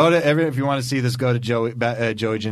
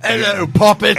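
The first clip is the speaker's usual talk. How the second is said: loud, higher pirate sneer; hammed-up pirate villain; 8 semitones higher, 6 dB louder than usual. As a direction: growl it like a movie pirate. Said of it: "bad guys in Pirates of the Caribbean".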